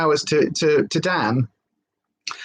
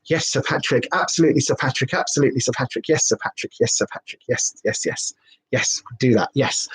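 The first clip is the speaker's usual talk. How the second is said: eager yes; 5 semitones lower than usual. Pretend. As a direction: melt into a submissive yes. Eagerly agreeing to pretend seduction.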